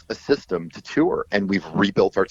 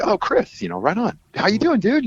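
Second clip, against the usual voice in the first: high, upbeat phone hello; imitated friendly greeting; 13 semitones higher than usual. Musician answering Chris's unexpected call, imitated friendly greeting. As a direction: act out a chirpy catch-up greeting.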